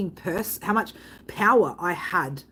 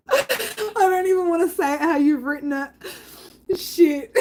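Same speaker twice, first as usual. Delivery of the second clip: helpless laughter; 7 semitones higher than usual. Real, not acted. Streamer losing it over how a viewer wrote a message, crying with laughter. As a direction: lose it completely, laughing and wheezing.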